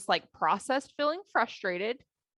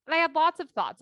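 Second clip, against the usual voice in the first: ordinary talk, nothing marked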